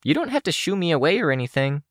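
lightly voiced young woman; Kenzie voice. A narrator's reading. Kenzie continues.

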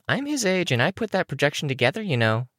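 put-on high-pitched, quick child character voice; Looksee. A narrator's reading. Looksee objecting about the projection, high-pitched. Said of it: "Looksee said".

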